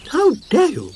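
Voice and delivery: high, loud indignant outburst; be outraged; indignant cry. A narrator's reading. Snork Maiden defends Moomintroll, high and indignant. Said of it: "cried the Snork Maiden".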